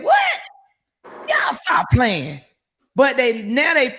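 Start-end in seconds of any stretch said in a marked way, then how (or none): 0.0-2.4 high startled cry, then slow; woman woken at night hearing she won; shocked disbelief. Retold or imitated. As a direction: squeal it like you don't believe it. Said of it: "I said".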